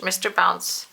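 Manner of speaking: ordinary delivery, nothing marked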